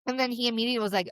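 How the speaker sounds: normal talking voice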